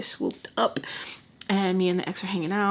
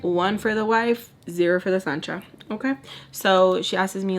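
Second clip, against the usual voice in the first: usual speaking voice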